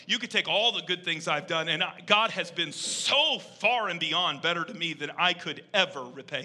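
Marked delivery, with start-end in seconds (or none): none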